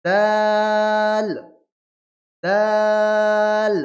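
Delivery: দাল drawn out very long and even; three alif stretch. Shown as a demonstration. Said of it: "তিন আলিফ টান দিয়ে পড়তে হবে".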